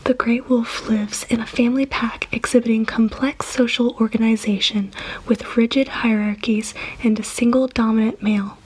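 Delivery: ordinary delivery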